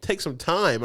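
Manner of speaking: high, easy mock doctor; doctor's voice. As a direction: raise the pitch to play the doctor. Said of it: "He's like".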